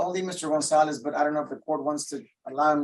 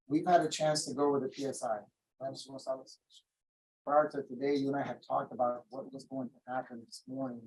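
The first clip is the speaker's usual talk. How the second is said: ordinary delivery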